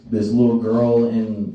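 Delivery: usual speaking voice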